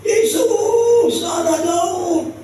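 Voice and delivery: acted cry, very high and drawn out; Bartimeus. Bartimeus crying out to Jesus over the crowd, shouting. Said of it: "Dia teriak".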